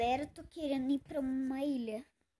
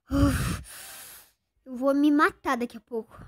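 loud frustrated exclamation; exasperated loud complaint; loud exasperation; 3 semitones higher, 10 dB louder than usual. Real, not acted. Exasperated outburst about game.

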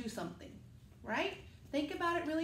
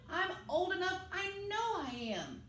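put-on higher, pleading child voice; a small boy insisting he's old enough; little Sam insisting; 6 semitones higher than usual.

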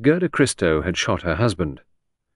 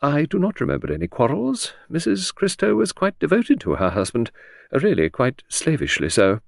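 higher-voiced secretary with an edge of disdain; higher, faintly contemptuous; faint contempt; 7 semitones higher than usual. A narrator's reading. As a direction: let a little contempt creep into a formal reply. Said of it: "faint edge of contempt in her voice".

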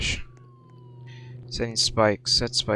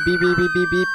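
imitated car horn; 8 semitones higher, faster than usual.

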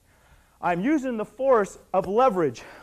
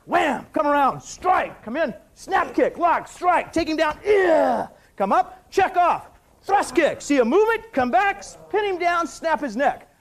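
sharp, punchy, higher-pitched calls over each move; barked fight commands; 7 semitones higher, 5 dB louder, slower than usual. Shown as a demonstration. Instructor calling out moves to students, barked commands. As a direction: call out every strike like a live drill.

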